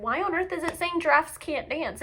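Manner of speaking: ordinary delivery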